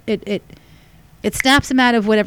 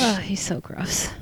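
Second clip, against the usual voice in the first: soft unvoiced whisper, slow; 11 dB quieter, slower than usual. Real, not acted. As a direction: whisper it in disgust. Teasing about gross dog.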